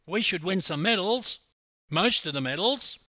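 loud, high, cocky bragging voice; loud cocky larrikin bragging; brash Aussie boast. A narrator's reading. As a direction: swagger up to the officer and announce it. Hammer boasting to customs officer.

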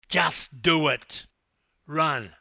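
loud, drawn-out urging; yelling. Acted. Teammate urging the swimmer to run, yelling. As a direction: holler each word to push them on. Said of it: "yelled Jody".

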